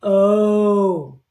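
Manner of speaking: O held long and loud; long vowel O. Shown as a demonstration. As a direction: stretch O out as its own syllable. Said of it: "we're gonna read this one like this".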